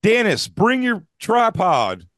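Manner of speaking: high, slow mock call out.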